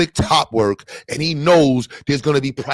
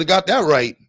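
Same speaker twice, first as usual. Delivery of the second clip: ordinary talk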